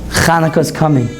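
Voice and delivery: usual speaking voice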